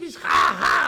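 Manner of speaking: high, loud, breathy shouted ha ha; shouted tic ha ha done high and loud; imitated tic in sleep. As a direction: mimic a yelping tic. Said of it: "he falls asleep and it gets worse".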